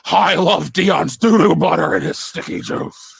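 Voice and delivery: high, loud, slow horse voice; creepy cartoon horse character; talking horse. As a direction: ham it up as a horse with a touch of the Joker. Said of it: "like a horse messing with the Joker".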